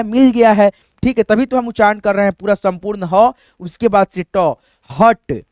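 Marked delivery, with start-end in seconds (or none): none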